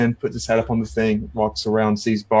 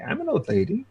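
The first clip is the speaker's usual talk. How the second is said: put-on old lady voice; 9 semitones higher, 4 dB quieter than usual. Player voices old lady character.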